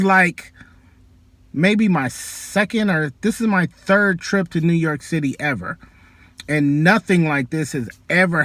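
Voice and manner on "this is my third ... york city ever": ordinary talk, nothing marked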